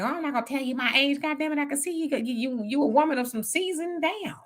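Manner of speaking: a hint of a coy lady hiding her age; mocking vain woman. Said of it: "I'm not one of those women that's like".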